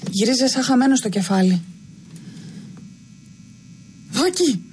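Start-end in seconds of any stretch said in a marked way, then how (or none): 4.1-4.6 anguished cry. A narrator's reading. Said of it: "άρχισε να κραυγάζει κι αυτός με αγωνία".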